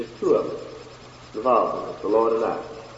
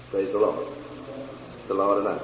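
usual speaking voice

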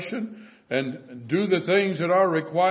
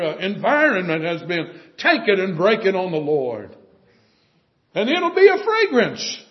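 louder, higher, driving sermon climax; fervent preaching; 5 semitones higher, 7 dB louder than usual. Acted. Preacher urging the congregation, fervent loud preaching. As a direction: preach it with full force to the congregation.

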